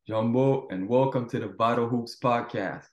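ordinary talk, nothing marked

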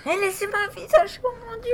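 high, fast frightened wail; panicked crying. Acted. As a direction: cry out in panic. Spider on face, panic.

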